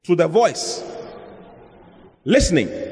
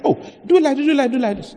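mimicking advice; 9 semitones higher, faster than usual. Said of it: "something can be said".

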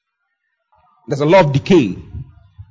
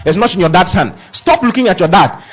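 normal talking voice

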